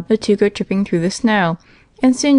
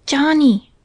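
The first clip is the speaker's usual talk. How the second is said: plain reading voice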